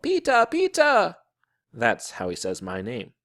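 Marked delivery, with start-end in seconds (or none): 0.0-1.2 put-on very high, loud little-boy call. A narrator's reading. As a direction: call out in a toddler's piping voice. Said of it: "until he called".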